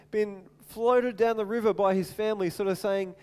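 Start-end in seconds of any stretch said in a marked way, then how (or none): none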